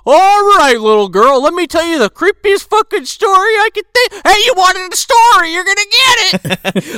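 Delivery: loud, menacing storyteller uncle; creepy uncle voice. Acted. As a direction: loom over the kid with a scary story. Answering the little girl as uncle.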